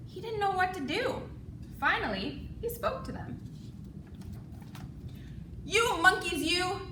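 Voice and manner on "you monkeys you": loud scolding. Acted. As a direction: scold the monkeys. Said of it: "he said".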